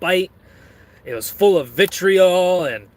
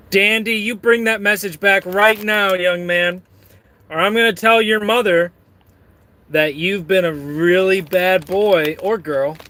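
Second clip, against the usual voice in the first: higher, stern telling-off; mock parental scolding; 3 semitones higher than usual.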